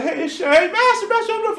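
high, loud pleading cry; yelling. Acted. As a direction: bang on the door and shout. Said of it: "they're yelling".

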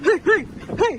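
real high-pitched cries of alarm; startled yelps. Real, not acted. Parent on the glass bridge with the child, startled yelps. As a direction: yelp it three times, startled.

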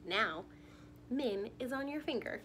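ordinary talk

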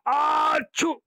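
long high build-up exploding into "achoo"; hammed-up, very high-pitched sneeze; huge comic sneeze. A narrator's reading. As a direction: sneeze like a tickled monster. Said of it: "राक्षस को आई बड़ी भयानक".

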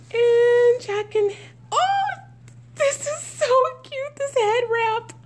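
high-pitched cooing excitement; real thrilled squeal; delighted squeal. Real, not acted. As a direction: gush high over something cute. Delighted at the head wrap.

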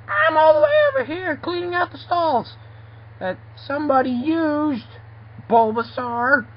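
high-pitched toy creature voice, calling out; high character voice. Acted. Character calling from over by the stalls, high-pitched call. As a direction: pitch it way up, loud at first.

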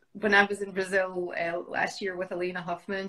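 ordinary talk

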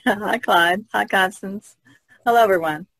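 ordinary talk, nothing marked

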